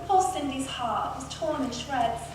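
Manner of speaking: ordinary delivery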